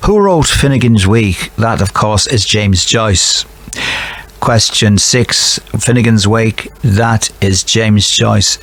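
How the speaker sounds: playful Irish lilt on the quiz answers; hammed-up Irish accent; put-on Irish accent. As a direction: indulge in a lovely Irish accent. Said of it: "excuse to do that lovely accent".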